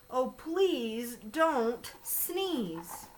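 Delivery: long, dragged plea; slow pleading. Acted. Fish begging the elephant, very slow plea. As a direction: draw out please don't sneeze, very slowly. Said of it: "slow pleading refrain".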